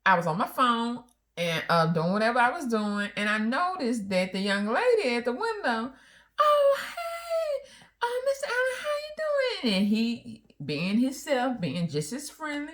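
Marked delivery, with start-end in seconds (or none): 6.4-9.7 much higher, sugary greeting; flirty bank teller. Retold or imitated. Wife mimics teller greeting her husband sweetly, much higher.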